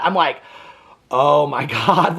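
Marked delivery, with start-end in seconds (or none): 1.1-2.2 slow, lower "oh my God" through a laugh; laughing disbelief. Retold or imitated. Reacting to the Cash App money train, slow laughing disbelief.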